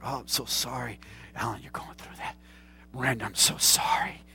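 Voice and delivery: whispered mock sympathy; soft whisper. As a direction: breathe the apology like a too-nice pastor. Nice pastor mock sympathy whisper.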